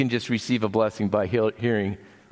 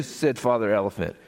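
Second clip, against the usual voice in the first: ordinary delivery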